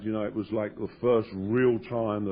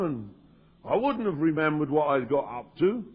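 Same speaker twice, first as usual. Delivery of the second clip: usual speaking voice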